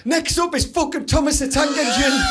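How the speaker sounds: shouting presenter parody. Retold or imitated. Mocking a children's presenter, shouting at viewers. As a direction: bellow the link like an unhinged presenter. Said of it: "She went".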